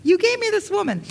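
accusing Adam. Acted. Acting out Adam blaming God.

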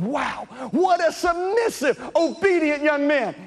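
high, slow, impressed bystander voice; a hint of an impressed neighbour, high and slow; admiring onlooker. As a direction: marvel at the good boy, drawn out.

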